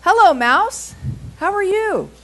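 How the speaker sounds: high, loud, bouncy hello; cheery frog greeting. Acted. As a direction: greet him big and bright as a friendly frog. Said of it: "and Frog says to him".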